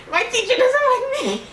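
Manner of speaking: high mock whine of a kid; mimicking her son. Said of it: "That's what he always says".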